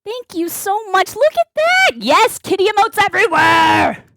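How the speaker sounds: high, rising to a loud whoop; excited cheer. Real, not acted. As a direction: build to a delighted high shout. Cheering the chat's emotes.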